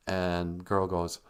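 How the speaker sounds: ordinary delivery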